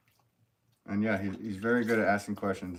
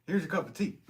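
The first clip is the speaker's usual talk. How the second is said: high, silly little put-on voice; playful sticker voice; 11 semitones higher than usual. Streamer voicing a cup of tea sticker, high and playful. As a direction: pipe it up high, half laughing.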